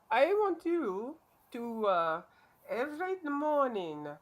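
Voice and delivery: put-on high, loud, slow Indian accent. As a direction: imitate a doctor with an Indian accent. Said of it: "I was doing an Indian accent".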